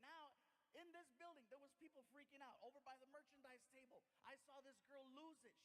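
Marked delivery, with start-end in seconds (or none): none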